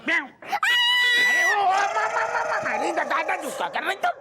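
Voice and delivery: long, very high scream tumbling into stammering; panicked yell. Acted. Startled man cries out at what she is doing, panicked. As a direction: shriek in alarm, then stutter the protest.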